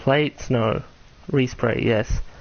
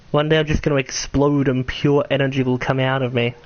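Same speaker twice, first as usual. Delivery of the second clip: ordinary talk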